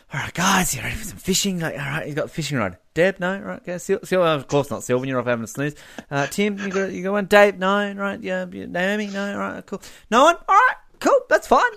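soft, hesitant mock invitation to the group; half-voiced skit of a rejected invite; acted asking around. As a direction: play someone hopefully polling mates and getting no takers. Acted asking around for fishing partners.

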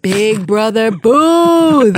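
loud, slow grand title call; grand announcement. Pretend. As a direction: boom it out like a ring announcer.

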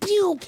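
high-pitched laser zap sound; squeaky ray-gun pew. Pretend. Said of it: "And they're like".

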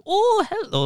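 high-pitched cod Welsh singsong; mock a bad attempt at Welsh. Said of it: "they'll just go like".

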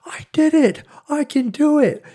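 put-on high, far-off girlish triumph; mock girlish "I did it"; excited triumph. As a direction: squeal it like a proud beginner. Girl finally plays the hook.

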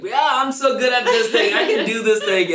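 high, loud, laughing boast; hammed-up cocky self, giggling; mock overconfident self. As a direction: brag like you think you're great. Mocking own overconfidence.

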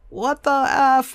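high, loud, slow exasperated outburst; mock the angry thirty-year-old; imitating upset son. Mother imitating her son's upset at the wrecked car, high and loud. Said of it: "of course he's upset".

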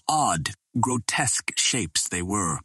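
plain reading voice

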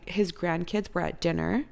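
ordinary delivery

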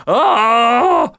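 loud, high "ohh" of anticipation; long high-pitched fan squeal; overjoyed, drawn-out "oh"; excited groan. Real, not acted. Gushing over creating mechs.

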